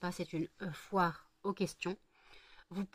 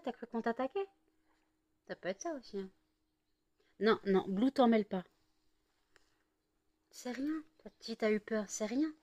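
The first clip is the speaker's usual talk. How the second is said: raised, gentle pet talk; reassuring a pet; 4 semitones higher than usual. Real, not acted. Owner comforting her cat after the fight, gentle and high. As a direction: soothe a scared cat.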